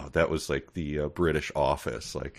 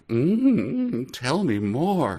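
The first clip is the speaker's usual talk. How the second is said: high, slow, swooning coo; mock flirty; 8 semitones higher, much slower than usual. Co-host mocking Maria swooning over the computer talk, high slow coo. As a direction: purr it like a smitten waitress hanging on every word. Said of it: "her going like".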